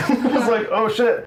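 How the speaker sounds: high, laughing through the words. Retold or imitated. Retelling the dog hopping up to his window, laughing. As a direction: let the laugh break through it.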